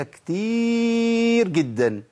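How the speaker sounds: كتير drawn out very long on one held note; emphatic stretching. Real, not acted. Teacher stresses how common errors are. (مد كلمة كتير للتأكيد)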